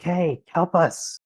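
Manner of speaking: lost voices calling through the gateway; pleading voices. Acted. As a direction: beg from beyond the portal. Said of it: "they're just like".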